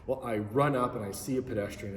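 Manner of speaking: ordinary delivery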